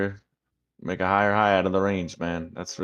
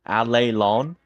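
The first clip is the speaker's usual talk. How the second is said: put-on trying the Southern accent, higher and louder; failed Southern accent; 4 semitones higher, 6 dB louder, slower than usual. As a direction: attempt the Southern phrase, unsure. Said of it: "You ain't from the South, so you can't say it".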